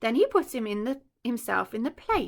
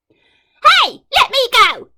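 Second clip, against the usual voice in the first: very high, much louder panicked yell; crocodile yelling; 13 semitones higher, 14 dB louder, much slower than usual. Acted. Dangling crocodile begging the elephant, high panicked yell. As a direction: squeal to be let go. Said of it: "yelled the enormous crocodile".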